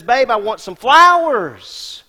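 a wife coaxing her husband, drawn out; wife asking for flowers. Retold or imitated. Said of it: "that little honey wife of yours who says".

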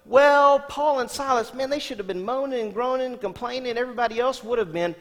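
high, loud scoffing voice; mock skeptic. Listener imagines what Paul and Silas should have done, mock scoffing. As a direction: scoff at them like a doubter. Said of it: "you go".

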